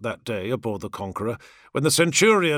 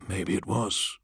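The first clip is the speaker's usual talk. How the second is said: quiet agreement; 6 semitones lower, 7 dB quieter, slower than usual. A narrator's reading. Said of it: "Inzar agreed".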